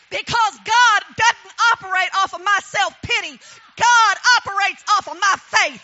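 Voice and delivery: loud, ringing preacher's voice; full force preaching. Acted. Testifying to the congregation about her faith, preaching loud. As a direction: preach it out to the back row.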